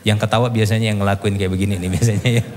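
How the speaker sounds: ordinary talk, nothing marked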